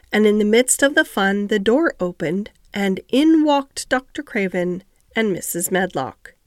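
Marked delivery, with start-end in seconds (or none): none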